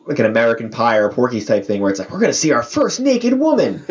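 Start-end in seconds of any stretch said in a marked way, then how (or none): 2.1-3.8 high-pitched, hammed-up eager teenager; mock teen excitement. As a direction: play a thrilled teen-movie kid, pitched up. Mock teen-movie line, acted excitement.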